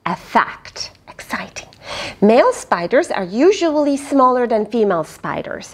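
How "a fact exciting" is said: unvoiced secret whisper; hushed storyteller whisper. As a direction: breathe it like a secret. Whispered aside while reading.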